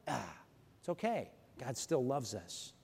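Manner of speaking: hushed, tender assurance; gentle reassurance. Acted. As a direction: settle them down gently. Tender reassurance after lament.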